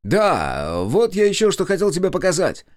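high, loud, breezy cover-up. A narrator's reading. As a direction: raise the voice cheerfully for others to hear. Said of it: "тут же бодро повысил голос". (бодро, повысив голос)

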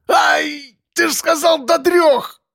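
very high-pitched outraged cry; yelling in pain. A narrator's reading. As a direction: shriek at being tricked as the pain hits. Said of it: "заорал участковый". (истошный крик от боли)

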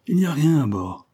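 lightly voiced desperate sailors; insistent crewmen. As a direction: insist as the destitute crew. Crew insists the ship is empty.

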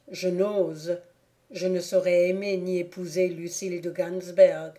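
usual speaking voice

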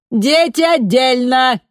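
high, loud, slow command; commanding megaphone order. A narrator's reading. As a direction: bark the order at the crowd. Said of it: "приказала женщина".

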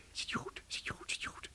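soft whispered check. Real, not acted. Professor whispers to girl in cupboard. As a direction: whisper to someone hidden.

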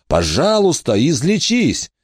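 high, urgent plea to heal; desperate pleading. A narrator's reading. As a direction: beg for the wound to close.

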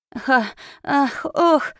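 strangled gasps. Acted. Man choked, gasping.

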